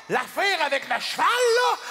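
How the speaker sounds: high, loud incredulous cry; horrified disbelief. Retold or imitated. Hearing about horseback riding, a high horrified cry. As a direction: squeak out your disbelief.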